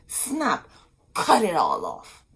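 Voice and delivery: usual speaking voice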